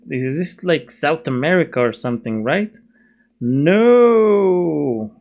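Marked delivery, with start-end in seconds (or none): 3.4-5.1 long, higher drawn-out no; mock-dramatic denial. Vlogger knocking down his own guess, mock-dramatic denial. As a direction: stretch the no out theatrically.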